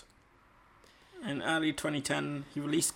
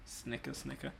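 whispered snicker; 3 semitones lower, 11 dB quieter than usual. Pretend.

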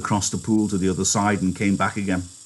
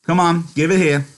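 raised, higher-pitched order; stern demand; 9 semitones higher, 5 dB louder than usual. Acted. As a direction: demand it firmly, louder and higher. George demands what Lenny hides.

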